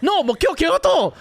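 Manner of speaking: high-pitched, hammed-up correction; mock exasperated insistence. Acted escalating argument with driver.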